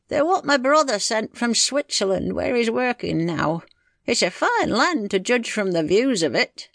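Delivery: country husband. Acted. Husband showing John the postcards, in character. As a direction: village man showing off his pictures.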